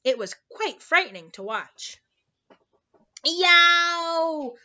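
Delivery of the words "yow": loud, high giant's howl; drawn-out high roar of a giant; roared yowl. A narrator's reading. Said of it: "roared the flesh lump eater".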